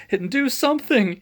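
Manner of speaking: high, loud desperate plea; begging. Acted. Judge begging the Ghostbusters to save him. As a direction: beg for help in a panic. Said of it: "he begged the Ghostbusters".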